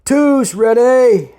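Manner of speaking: long, high military bellow; shouted call. A narrator's reading. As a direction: bellow it slowly like a drill sergeant. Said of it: "Sergeant Sullivan shouted".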